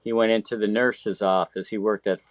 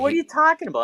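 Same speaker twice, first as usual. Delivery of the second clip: high-pitched disbelief; incredulous; much faster than usual. Retold or imitated. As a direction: shoot the question way up, offended. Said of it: "I go".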